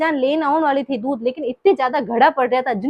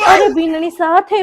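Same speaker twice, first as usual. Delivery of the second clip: louder, higher call to stop her; loud, raised call; 5 semitones higher, 8 dB louder than usual. Acted. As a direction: call out to the daughter-in-law before she leaves. Mother-in-law calls her back.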